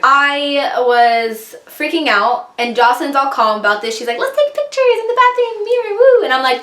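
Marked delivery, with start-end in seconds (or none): 4.2-6.2 very high, chirpy imitation of a friend; mock carefree friend. Friend suggesting bathroom mirror pictures, mock carefree squeal. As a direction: mimic an oblivious friend way up high.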